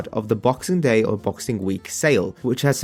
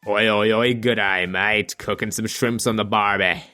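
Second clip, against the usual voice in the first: hammed-up tourist version of an Australian accent; mock Australian accent; 2 semitones lower than usual. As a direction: parrot the clumsy foreign take on an Australian accent. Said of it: "Whenever somebody tries to imitate an Australian".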